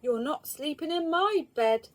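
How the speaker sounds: the middle bear refusing; medium bear voice. Acted. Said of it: "said the medium bear".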